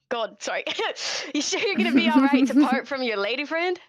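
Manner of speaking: ordinary delivery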